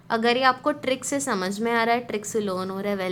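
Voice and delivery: ordinary talk, nothing marked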